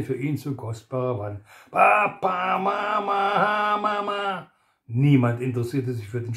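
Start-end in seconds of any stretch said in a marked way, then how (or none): 1.7-4.5 put-on high, sing-song child's wail; wailing toddler. A narrator's reading. Toddler wails for his parents, high sing-song cry. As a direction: cry out like a small child for mama.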